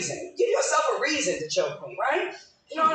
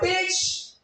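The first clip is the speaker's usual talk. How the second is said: yell back; 9 semitones higher than usual. Retold or imitated. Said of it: "I wanna yell back and be like".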